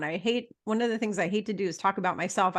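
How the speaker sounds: normal talking voice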